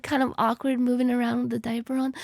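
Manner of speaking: usual speaking voice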